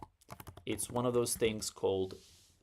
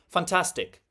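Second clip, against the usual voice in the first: ordinary talk, nothing marked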